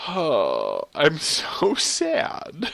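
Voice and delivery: high, very slow whine; mock-sad wail. Real, not acted. Player mourning lost levels, high hammed-up sadness. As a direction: stretch it into a pouty high wail.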